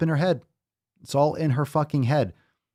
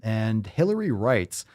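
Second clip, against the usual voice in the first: ordinary talk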